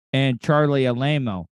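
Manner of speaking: ordinary talk, nothing marked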